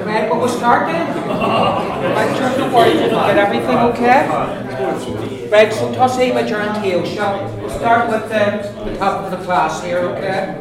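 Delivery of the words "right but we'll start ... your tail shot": calling the room to order, loud and bright; loud classroom call to begin; raised voice. Real, not acted. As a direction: speak up over the class chatter. Teacher calling class to start.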